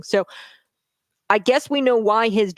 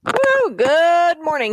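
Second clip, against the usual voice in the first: big whooping hello, much higher and louder; excited greeting; 10 semitones higher, 6 dB louder, much slower than usual. Real, not acted. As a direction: open the show with a loud, bright cheer. Cheery show opening greeting.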